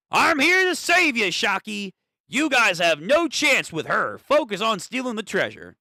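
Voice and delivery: high-pitched put-on southern accent. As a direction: play a rescuer with a southern accent. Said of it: "fucking southern accent".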